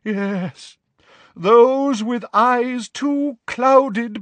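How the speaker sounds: high, slow theatrical seer; mournful prophet lamenting her fate; dramatic, wavering. A narrator's reading. Trelawney to the class, slow and wavering. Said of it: "in a deep, dramatic, wavering voice".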